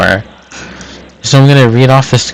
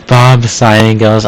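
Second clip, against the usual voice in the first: plain reading voice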